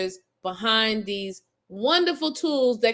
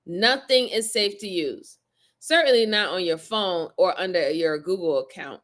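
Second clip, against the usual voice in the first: ordinary talk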